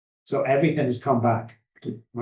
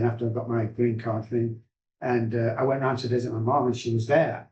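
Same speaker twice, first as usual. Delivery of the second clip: normal talking voice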